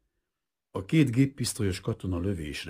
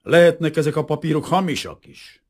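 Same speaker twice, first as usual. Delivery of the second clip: suspicious sneer; 11 semitones higher, 10 dB louder than usual. A narrator's reading. Lieutenant sneers at papers.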